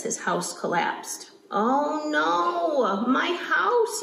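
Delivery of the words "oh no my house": high, drawn-out wail of dismay; storybook yell. Acted. Reader as Beaver whose house collapsed, hammed-up high yell. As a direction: cry out in mock alarm, stretching the "no". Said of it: "yelled Beaver".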